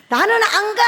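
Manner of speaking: high, loud mock wail; hammed-up bawling protest. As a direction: bawl it out like a stubborn child refusing. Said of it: "이러고 삼 일을 운 거야". (떼쓰며 우는 흉내)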